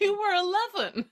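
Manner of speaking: high, loud incredulous laugh-line; amused disbelief. Acted. Friend teasing her about a childhood proposal, loud disbelief.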